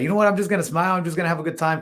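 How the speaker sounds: lightly retold upbeat pep talk; upbeat resolve. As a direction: tell yourself you'll make the best of it. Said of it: "decide to just have a good attitude".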